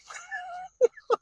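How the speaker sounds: high-pitched burst of laughter; real laughter. Real, not acted. Storyteller amused by the fish sandwich detail, laughing. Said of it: "I wanted to laugh".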